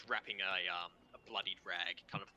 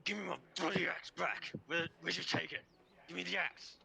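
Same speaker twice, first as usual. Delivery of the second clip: higher-pitched, angry demand; growing heated, demand your property back; angry prisoner; 8 semitones higher, 3 dB louder than usual. Acted. Bound prisoner demanding his axe back, angrily. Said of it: "he's just looking just angry".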